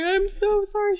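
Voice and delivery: high-pitched, pleading little apology; lightly acted whimper of regret; mock tearful apology. Retelling her tearful apology to boss.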